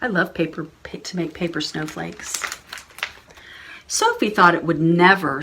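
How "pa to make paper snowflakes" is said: plain reading voice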